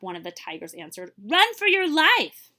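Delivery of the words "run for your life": slow, loud, high urging; slow, louder panicked warning; urgent warning. Acted. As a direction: stretch it out, begging them to flee. Tigers warn the rhino.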